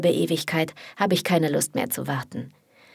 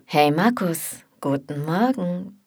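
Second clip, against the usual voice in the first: unbothered; slower than usual. Acted. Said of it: "Liam nimmt das Ganze gelassen".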